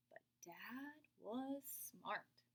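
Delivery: very slow soft whisper; slow admiring whisper; knowing whisper. A narrator's reading. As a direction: let it land slowly, hushed. Admiring narration.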